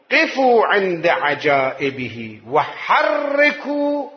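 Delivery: slow, weighty reading; slow emphatic quote. Shown as a demonstration. Preacher urging to pause at the wonders, slow. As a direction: dwell on every word. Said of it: "بتأني".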